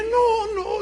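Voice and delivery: high, loud tearful protest; imitated child crying. Daughter refusing her mother's comfort, wailed tearfully. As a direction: cry out in mock childish despair.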